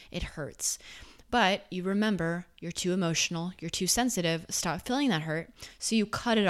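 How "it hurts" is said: breathy whispered aside. Acted. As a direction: drop to a hushed whisper. Naming pain after a breakup.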